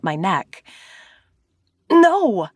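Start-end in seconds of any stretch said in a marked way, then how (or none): 1.9-2.5 high, tight "No" through a clenched throat; choked no. A narrator's reading. Narrator choking out a denial to angry Zane. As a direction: squeeze it out, breathless. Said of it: "through my completely clenched throat".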